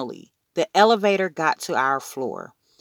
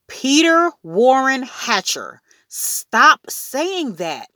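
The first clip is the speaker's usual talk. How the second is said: high, drawn-out scolding holler; 10 semitones higher, 4 dB louder, slower than usual. A narrator's reading. Mother scolding Peter by full name, hollering. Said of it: "Mom hollered".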